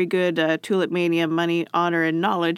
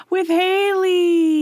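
high sing-song; 11 semitones higher, 5 dB louder, much slower than usual. Pretend. Sing-song intro name.

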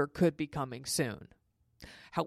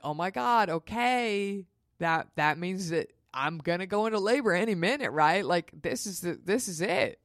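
higher, giddy retold realization; giddy excitement; 3 semitones higher than usual. Mother realizes labor may start any minute, giddy excitement. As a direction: build to a thrilled, rising finish. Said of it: "And I'm laughing".

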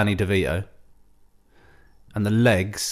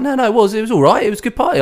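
high, louder put-on voice; mock insistent friend; 11 semitones higher, 5 dB louder, faster than usual. Friend insisting to the daughter in a high, louder mock voice. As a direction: push the point, oblivious.

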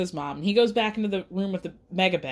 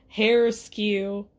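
ordinary delivery

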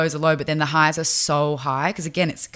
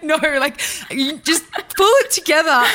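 laughing tough love; 11 semitones higher, 5 dB louder than usual. Retold or imitated. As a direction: bark the order while cracking up.

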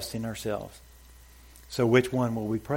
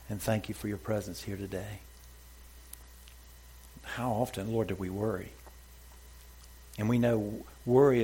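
prayer; 4 dB quieter than usual. Real, not acted. Pastor leads the congregation in soft closing prayer. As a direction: pray gently to the Father.

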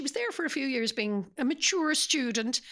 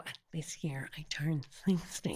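confiding whisper; 6 semitones lower, 5 dB quieter than usual. Real, not acted. Confessing her age in a hush.

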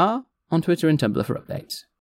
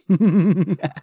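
high villain cackle; 6 semitones higher, 4 dB louder than usual. Acted. Evil clown laugh.

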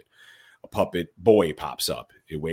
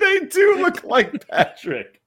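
laughing; 6 dB louder, slower than usual. Real, not acted. Laughing at Patrick comparison.

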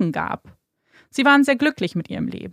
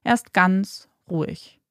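usual speaking voice

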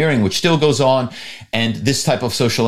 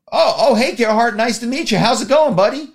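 much higher, gushing, overly friendly hello; eager greeting; 9 semitones higher than usual. Retold or imitated. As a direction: greet the important caller like an old buddy. Target eagerly greets fake Gerhard.

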